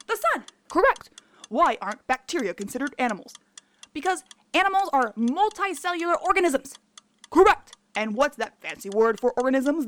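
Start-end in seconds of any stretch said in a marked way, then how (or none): 0.7-1.1 loud, upbeat game show host; quiz host. Acted. Quiz host confirming answers. 7.3-7.7 loud, high game show host; quiz host. Acted. Quiz host confirming answers.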